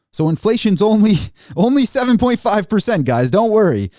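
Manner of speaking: mocking, pitched high and loud with a laugh; sarcastic. Real, not acted. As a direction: scoff at the official number like it's a joke. Scoffing at official inflation number.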